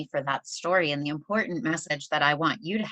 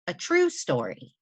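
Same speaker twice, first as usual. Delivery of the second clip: usual speaking voice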